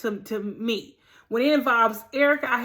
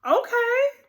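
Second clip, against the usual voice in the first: surprised okay; 6 semitones higher, 4 dB louder, much slower than usual. Retold or imitated. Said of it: "I was like".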